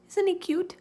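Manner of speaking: put-on high, gushing little-girl squeal; eager and high-pitched; excited child. A narrator's reading. Excited child shows off pet.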